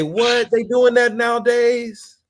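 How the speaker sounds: high, loud mock-innocent squeal of surprise; mock clueless mother. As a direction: play dumb like a shocked mother. Imitating mothers playing dumb.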